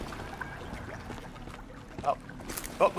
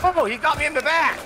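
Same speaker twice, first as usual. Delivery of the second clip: surprised shout; 10 semitones higher, 5 dB louder than usual. Real, not acted. Hit by enemy.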